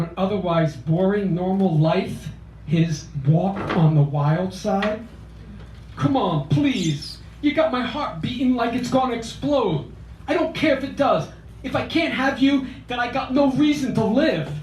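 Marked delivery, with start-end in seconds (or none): 5.9-14.5 pleading higher South Philly voice; South Philly accent. Acted. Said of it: "in his South Philly accent".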